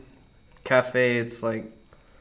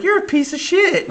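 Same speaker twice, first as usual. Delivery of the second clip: mock outrage; 5 dB louder than usual. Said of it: "It's like".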